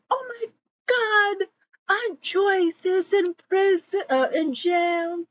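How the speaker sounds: high-pitched, mock-wailing gossip; shocked cousin. [name] reacting to a text about her aunt in jail, mock shock. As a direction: squeal the news in a high voice.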